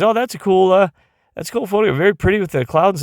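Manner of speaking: light mock voice of an unimpressed friend; imitating friend. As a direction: lift it up high like a casual onlooker. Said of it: "and he goes".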